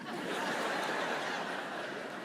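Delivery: soft unvoiced chuckle; breathy laugh. Real, not acted. Pastor laughing at his own foolishness, soft chuckle. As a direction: laugh at yourself.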